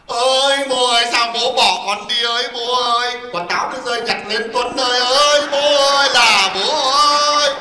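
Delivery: sing-song sobbing lament, put on; mock funeral wail. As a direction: imitate a daughter wailing for her father. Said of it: "nó đang khóc tu tu".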